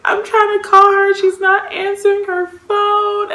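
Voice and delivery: high, loud whine of distress; acted tearful complaint; mock crying. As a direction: wail it as a hammed-up retelling. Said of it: "And I'm crying".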